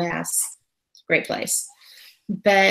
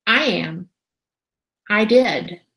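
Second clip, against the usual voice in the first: smug little spirit admitting it, hand on hip; snarky little voice; 2 semitones higher, 3 dB louder, slower than usual. Retold or imitated. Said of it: "this snarky little voice".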